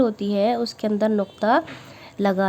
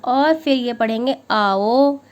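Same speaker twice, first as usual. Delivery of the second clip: ordinary talk, nothing marked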